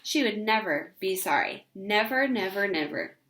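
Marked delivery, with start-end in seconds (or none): none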